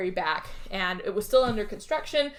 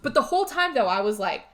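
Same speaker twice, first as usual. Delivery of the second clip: ordinary delivery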